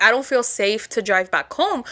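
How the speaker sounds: quiet worried admission; low and subdued. Retold or imitated. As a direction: sound drained and unsteady. Shaky low self-quote, slow.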